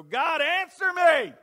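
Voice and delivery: high, loud, drawn-out yell at heaven; hammed-up frustrated cry to God; frustrated shout. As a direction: bellow it in mock exasperation. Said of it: "get a little frustrated sometimes".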